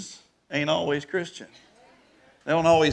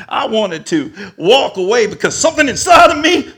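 normal talking voice

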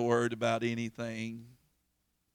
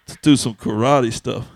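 mock tough shout; 17 dB louder than usual. Joking about fighting the man.

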